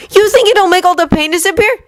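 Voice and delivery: hurt challenge. Acted. Yuri continues quarrel.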